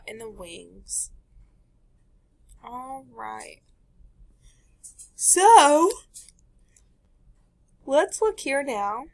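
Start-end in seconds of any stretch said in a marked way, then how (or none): 2.6-9.0 yawning. Real, not acted. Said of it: "Sorry, I keep on yawning".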